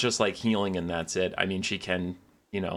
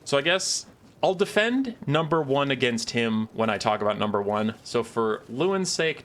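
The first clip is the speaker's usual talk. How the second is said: usual speaking voice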